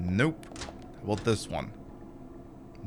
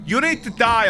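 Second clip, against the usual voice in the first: mock scolding; 7 semitones higher than usual. Scolding zombie while fighting it.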